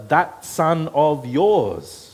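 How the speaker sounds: "that son of yours" said slow and loud; slow cold emphasis on "yours"; distancing stress. Shown as a demonstration. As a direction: drag out "that son of yours" coldly. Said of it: "puts distance between himself".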